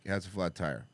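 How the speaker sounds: low, soft flat-toned confirmation; quiet deadpan echo of his question; deadpan repeat. Retold or imitated. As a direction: say it back drily. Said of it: "I'm like".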